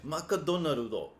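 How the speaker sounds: put-on Macadonaldo in a Japanese-accented echo. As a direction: imitate the friend's Japanese pronunciation. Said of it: "I'm like".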